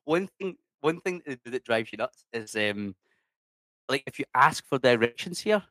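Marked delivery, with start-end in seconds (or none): none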